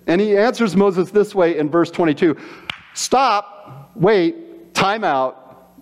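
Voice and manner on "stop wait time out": ordinary talk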